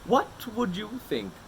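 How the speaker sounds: slow playful musing. Acted. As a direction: tease out the idea slowly. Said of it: "he mused".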